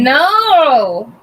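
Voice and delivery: long drawn-out high "no"; playful howl of protest; loud protest. Real, not acted. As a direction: wail a loud, stretched-out refusal. Playfully refusing to allow the story.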